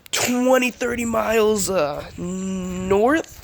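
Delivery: ordinary talk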